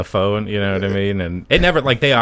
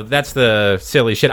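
normal talking voice

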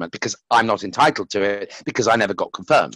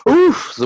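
high gasp into a slow oof; relieved gasp and oof; 4 dB louder than usual. Retold or imitated. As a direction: exhale the weight off. Relief remembered.

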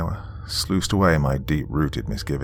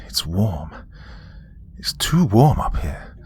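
worried, breathy whisper; 2 semitones higher, slower than usual. A narrator's reading. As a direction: whisper it, uneasy and hot. Boris whispers about the heat.

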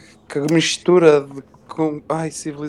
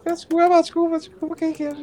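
put-on high girly voice; 12 semitones higher than usual. Girly apology, still high.

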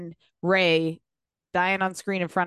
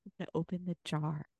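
mock ASMR; much faster than usual. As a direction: tease it like an ASMR video. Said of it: "one of those A S M R videos".

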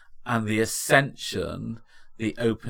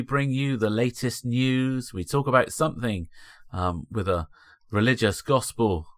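podcast announcer; 3 semitones higher, 4 dB louder than usual. Acted. Podcast outro read.

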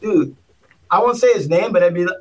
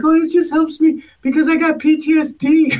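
lightly mocked meek voice, a bit higher; high-pitched voice; 7 semitones higher, 3 dB louder than usual. Candidate explaining the stress ball, meek and high. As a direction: play the nervous guy explaining his stress ball. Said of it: "he had a high-pitched voice".

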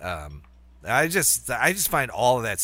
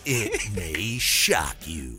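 clickbait video narrator; much slower than usual. Pretend. Clickbait parody continues.

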